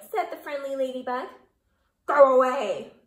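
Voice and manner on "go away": loud grouchy shout. A narrator's reading. Grouchy ladybug shouting the friendly one away, loud. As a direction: bark it at the other bug. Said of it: "shouted the grouchy ladybug".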